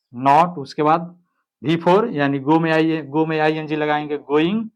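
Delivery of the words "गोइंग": ordinary talk, nothing marked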